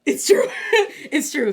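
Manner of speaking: laughing as you admit it; real laugh through the words; amused agreement. Real, not acted.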